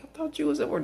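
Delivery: high, startled whiny voice; caught-out whine. Retold or imitated. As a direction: blurt it like a kid caught. Caught off guard by Kylie.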